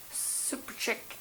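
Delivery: whispered fading echo of the name; cave echo. Pretend. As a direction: breathe it back softly like a distant echo. Name repeated as soft echo.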